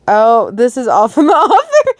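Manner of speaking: laughing through the words; amused laugh mid-line. Real, not acted. Reader laughing at the author's note.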